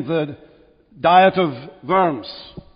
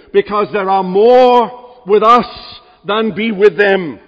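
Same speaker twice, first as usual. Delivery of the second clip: loud, soaring pulpit delivery, high and louder; preaching at full force; 3 semitones higher, 5 dB louder than usual. Acted. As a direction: build the congregation up with ringing conviction.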